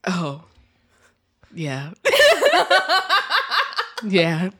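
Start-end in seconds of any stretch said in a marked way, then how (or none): 2.0-4.0 high squealing laugh; high burst of laughter. Real, not acted. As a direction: laugh out loud, surprised.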